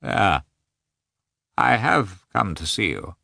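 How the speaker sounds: hollow, drained voice of a sick man. A narrator's reading. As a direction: speak hollow and empty, half numb. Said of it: "said Nikolai in a hollow voice".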